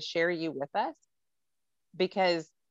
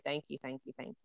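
hushed, deeply sincere thank you; soft heartfelt thanks; 4 semitones lower, 12 dB quieter, faster than usual. Real, not acted. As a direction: breathe the thanks out softly. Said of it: "from the deepest part of me say".

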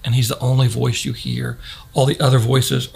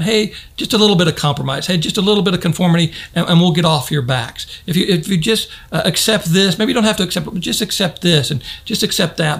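coaxing tempter; 5 semitones higher than usual. Acted. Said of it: "the world simply said to them".

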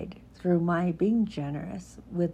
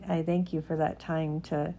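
ordinary talk

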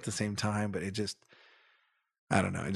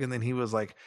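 ordinary talk, nothing marked

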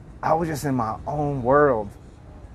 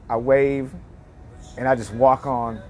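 ordinary talk

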